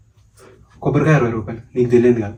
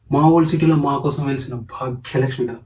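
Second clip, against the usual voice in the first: usual speaking voice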